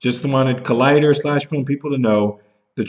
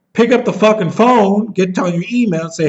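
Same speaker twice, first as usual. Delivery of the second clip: angry demand; 7 semitones higher than usual. Real, not acted. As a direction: order it, pitch jumping up.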